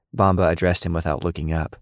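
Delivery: ordinary delivery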